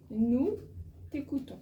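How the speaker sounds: ordinary delivery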